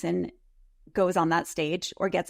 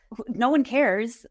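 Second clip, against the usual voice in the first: normal talking voice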